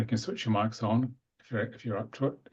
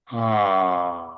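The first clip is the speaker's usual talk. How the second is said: a long, louder, open ah held steady; toned ah; 3 semitones lower, 6 dB louder than usual. Acted. Breathwork leader toning a long ah with the group. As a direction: sound the ah from the base up to the crown. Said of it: "It's the same movement".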